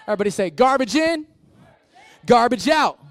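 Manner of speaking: high, loud call-out; rally the crowd. Real, not acted. Pastor leads a call and response, loud and high.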